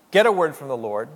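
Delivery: ordinary talk, nothing marked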